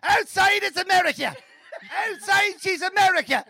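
high, belted-out Cavan lyric; sing-shout it in broad Cavan; Cavan accent. Retold or imitated. Mimicking the singer's chorus, high Cavan wail. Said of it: "like this in the Cavan accent".

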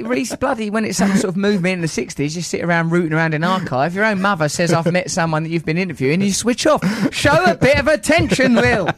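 loud, high comic scolding; mock telling-off. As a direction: build a pretend rant to a shouted finish. Mock telling-off of his guest.

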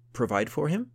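surprised. Acted. Farmer surprised by the question.

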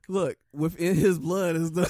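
words breaking into laughter; laughing. Real, not acted. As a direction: laugh through the sentence. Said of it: "You can't even get it out".

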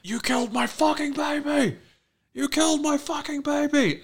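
very high-pitched acted scream; imitated shrieking fury; screaming accusation. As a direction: scream it as a hysterical, grieving mother. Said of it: "Shauna starts screaming at Misty".

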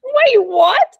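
high, loud disbelief; real startled double-take; astonished. Real, not acted. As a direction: blurt it out in shock, pitch leaping up. Reacts to sheep's surprising weight.

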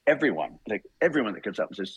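normal talking voice

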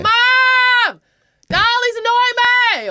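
put-on very high loud child whine; high loud tattling kid; whiny kid yelling. Kid dobbing to mum.

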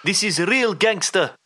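slow New York gangster drawl; Khabib impression. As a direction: tough New York accent, slowed down. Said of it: "Khabib was like".

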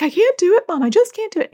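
put-on high, pleading child voice; a kid begging to be let off; whiny child. Child pleading with mom to skip exposure, high and whiny.

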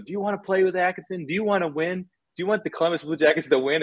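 lightly voiced coach pressing Panarin hard; coach grilling a player. Coach Torts grilling Panarin in the closed-door meeting, mock interrogation. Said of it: "he goes".

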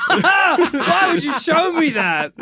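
laughing in horrified disgust; high, slow, grossed-out laugh; disgusted laughter. Real, not acted. Reacting to creepy Stuart Little image.

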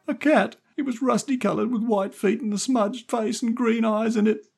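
put-on very high child's voice; upset little boy. A narrator's reading. Stevie describing the cat to Jerry, upset little boy. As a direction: pipe it out as an upset small boy.

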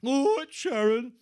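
husband agreeing to wife, high and loud put-on voice. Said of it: "[name] answering wife".

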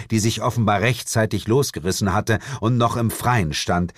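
usual speaking voice

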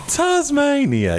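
drawn-out, high amazed Tasmania. Real, not acted. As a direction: stretch it out in delighted wonder. Amazed at caller's location.

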